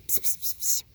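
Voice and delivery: soft unvoiced psst to call the dog. Real, not acted. Trainer softly psst-calling the puppy. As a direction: hiss psst to get her attention.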